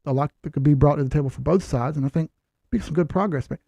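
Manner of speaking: a hint of a smooth interviewee; polished candidate voice. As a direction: sound like you know the job. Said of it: "But if I go in there and say".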